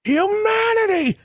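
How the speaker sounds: high, drawn-out shout of one word; high-pitched long holler; yelled out. Acted. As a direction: yell it out like an audience heckler, stretching it. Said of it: "You just yell out".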